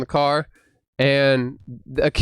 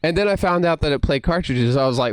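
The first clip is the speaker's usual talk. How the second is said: normal talking voice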